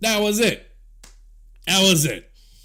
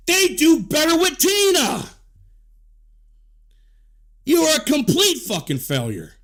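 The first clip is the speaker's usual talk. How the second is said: angry shout; 7 semitones higher than usual. Real, not acted. Angry outburst at her.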